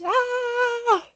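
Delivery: a short, high, excited squeal. Real, not acted. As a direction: squeal with delight at the news. Excited about moving to Scotland.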